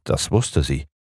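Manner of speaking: ordinary delivery, nothing marked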